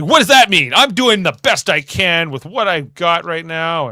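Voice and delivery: mock defensive outburst at Geordi. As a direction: snap back defensively. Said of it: "Troy gets defensive".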